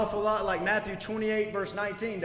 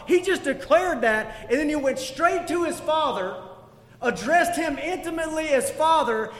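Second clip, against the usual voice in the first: higher, louder passionate preaching; preaching at full force; 6 semitones higher, 4 dB louder than usual. Acted. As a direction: drive the point home to the congregation.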